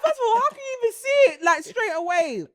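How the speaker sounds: high, loud mock outrage; incredulous protest. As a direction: question it in comic disbelief. Retold disbelief at hearing x-ray verdict.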